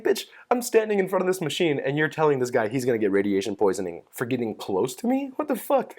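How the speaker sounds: high-pitched incredulous protest; indignant disbelief. Retold or imitated. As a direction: retell it as an outraged objection. Retold outraged reply to the assistant.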